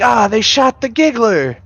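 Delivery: high-pitched hammed-up outcry; mock alarmed cry. Film character reporting the giggler was shot, mock alarm. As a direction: cry it out in comic dismay.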